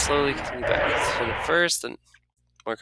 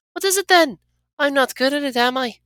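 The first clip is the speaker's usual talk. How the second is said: much higher, embarrassed; flustered companion voice; 4 dB louder than usual. Acted. Anna after the kiss asking the player, higher and flustered. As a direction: ask if you did it wrong, flustered.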